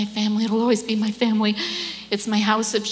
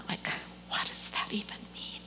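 whispered; 14 dB quieter than usual. Retold or imitated. Her puzzled reply to God.

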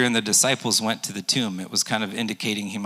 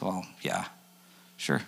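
reluctant Peter; 4 semitones lower, 9 dB quieter than usual. Retold or imitated.

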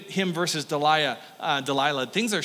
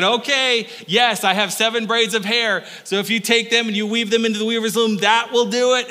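lightly voiced Samson; 5 semitones higher, 5 dB louder than usual. Samson confessing a false secret.

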